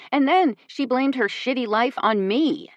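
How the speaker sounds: ordinary delivery, nothing marked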